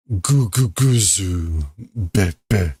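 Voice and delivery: slow, low, rumbling mock snore; comic snore read aloud; snoring sound. Snoring down the hallway.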